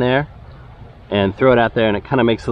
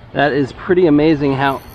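usual speaking voice

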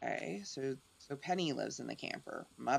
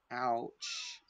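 ordinary talk, nothing marked

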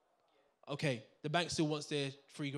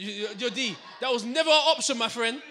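high, loud mock scolding; teasing shout; 7 semitones higher, 11 dB louder than usual. Real, not acted. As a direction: call him out with a laughing shout. Teasing audience member shouting.

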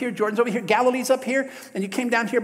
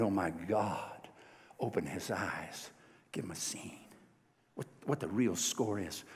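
slow, much softer, hushed plea to God; quiet prayer; 12 semitones lower, 12 dB quieter, slower than usual. Retold or imitated. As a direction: pray it low and slow, almost breathing it. Prophet prays quietly for servant.

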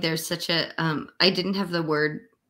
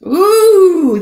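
much higher, much louder squeal; delighted ooh; 11 semitones higher, 15 dB louder than usual. Real, not acted. Artist thrilled at her drawing, squealing with joy.

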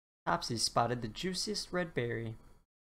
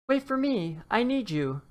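high loud desperate call; shouting; 9 semitones higher, 7 dB louder than usual. Acted. Dinosaur shouts after the fleeing leprechaun, loud and desperate. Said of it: "shouted Topsy".

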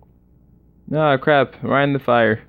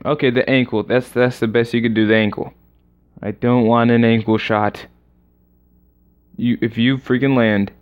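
ordinary talk, nothing marked

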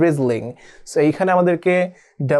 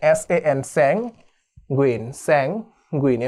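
letters then sanguine, very slowly; slow spelled out; much slower than usual. Shown as a demonstration. Teacher spelling for students, slow and deliberate. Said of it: "এভাবে ভাগ করে আমরা".

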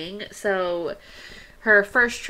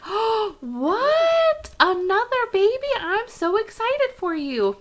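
gasp, then much higher and louder delight; gushing surprise; 13 semitones higher, 7 dB louder, slower than usual. Pretend. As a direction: squeal at the baby news. Acting Sim reacting to pregnancy news.

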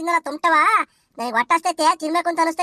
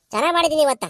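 normal talking voice

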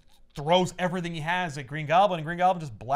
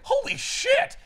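much higher startled yelp; mock shock; 5 dB louder, slower than usual. Peter startled by Goblin at his door, mock shock.

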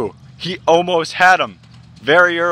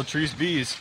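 ordinary talk, nothing marked

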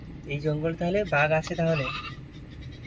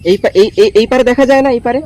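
almost shouting, higher pitch; loud raised voice; 4 semitones higher, 14 dB louder, faster than usual. Real, not acted. Talking loudly to a deaf old man. (চেঁচিয়ে বলা)

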